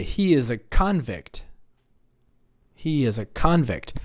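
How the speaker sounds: convict as CON-vict; first-syllable stress. Shown as a demonstration. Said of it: "everybody say".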